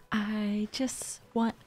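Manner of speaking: breathy annoyed whisper under the breath. Real, not acted. Player whispering her frustration at the wrong villager. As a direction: grumble it in a whisper.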